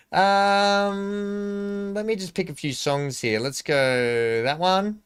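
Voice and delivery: put-on drawn-out Sydney accent, high on the um and slowing on the last line; a posed Sydney housewife choosing music; Sydney Housewives accent. Picking songs in a drawn-out Sydney Housewives accent. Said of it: "your Sydney Housewives accent".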